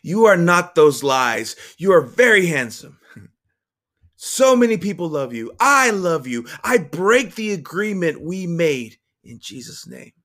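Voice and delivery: impassioned affirmation. A narrator's reading. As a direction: declare love to a hurting child.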